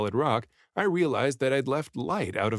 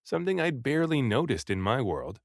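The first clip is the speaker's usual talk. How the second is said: ordinary delivery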